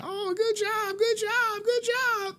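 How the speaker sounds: very high, cooing mock congratulations; singsong high-pitched praise; gushing praise. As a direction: imitate a fawning crowd of relatives. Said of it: "everyone was like".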